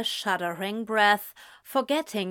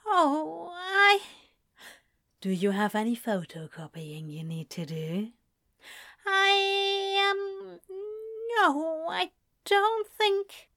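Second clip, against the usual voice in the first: higher, halting and flustered; flustered stammer; 6 semitones higher than usual. Acted. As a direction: stumble over the words, caught off guard. Aziraphale flustered by flirting.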